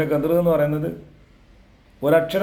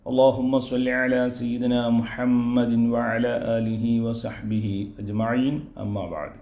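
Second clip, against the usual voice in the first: slow formal opening; 3 semitones lower, much slower than usual. Acted. Opening recitation.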